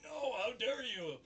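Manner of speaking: high, loud slow mock fury; outraged shout. Fan retelling vice principal exploding at the teacher. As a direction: bellow like a scandalized official.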